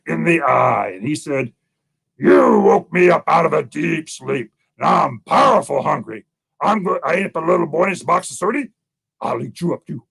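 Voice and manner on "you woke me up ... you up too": menacing hungry bear. Acted.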